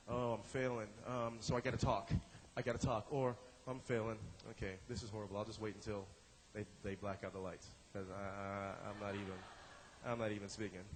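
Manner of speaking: low, quiet, giving-up mumble; defeated. Pretend. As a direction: play an improviser quietly sinking into failure. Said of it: "you know you failed and you communicate it".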